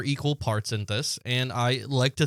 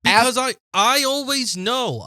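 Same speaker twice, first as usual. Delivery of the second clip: smug boast; 8 semitones higher, 4 dB louder than usual. Real, not acted. Smug claim about intuition.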